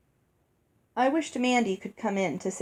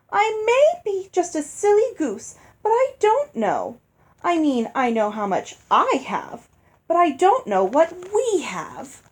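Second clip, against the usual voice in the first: high, sulky silly-goose wife; pouty stepmother; 8 semitones higher, 3 dB louder than usual. Acted. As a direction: whine it, playing the innocent. Stepmother figure voiced high.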